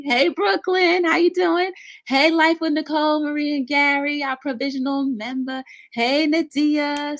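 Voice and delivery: high-pitched, bubbly hellos; sing-song high greeting; excited shout-outs. Real, not acted. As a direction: greet each viewer like a happy surprise. Calling out greetings to chat.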